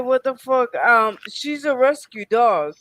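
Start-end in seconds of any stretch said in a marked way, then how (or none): 0.0-0.7 higher, louder mock outburst; retold what the fuck at the man walking closer; retold angry outburst. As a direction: snap it at the stranger. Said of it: "I'm like".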